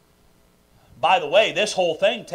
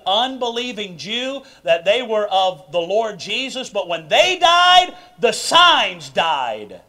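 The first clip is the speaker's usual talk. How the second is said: loud, slow, high-pitched preaching; full-force preaching; 4 semitones higher, 8 dB louder, slower than usual. Acted. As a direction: drive the point home, stretching each word.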